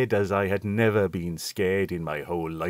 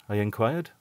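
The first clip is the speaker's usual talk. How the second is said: plain reading voice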